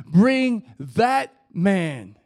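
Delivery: THAT MAN, each word loud and drawn out; emphatic command. Acted. Jesus calling one beggar out of many, loud drawn-out emphasis on THAT. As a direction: hammer "Bring that man" slowly, word by word. Repeated command against "all of them.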